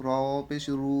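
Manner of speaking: "রু" ending on a long steady u; one-alif stretch. Shown as a demonstration. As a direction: hold the vowel of the last "রু". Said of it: "এক আলিফ টান দিয়ে পড়তেছি".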